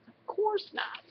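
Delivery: small, high squeak, kept soft; squeaky voice. A narrator's reading. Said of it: "Sam said in a squeaky voice".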